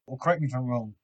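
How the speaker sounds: ordinary talk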